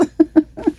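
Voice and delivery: high hiccuping giggle; hiccup laugh. Real, not acted. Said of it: "I have the hiccups right now".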